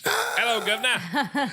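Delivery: put-on high Cockney-style British accent; mock British accent. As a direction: greet like a cartoon London cabbie, British accent. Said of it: "sounding British".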